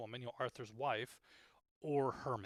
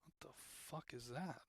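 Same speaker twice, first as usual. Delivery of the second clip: deadpan shocked mutter, slowed right down; voicing Hermit's slow shocked question; Hermit's line; 14 dB quieter, slower than usual. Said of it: "and goes".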